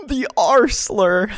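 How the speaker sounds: mock horror. Mock horror, echoing the video.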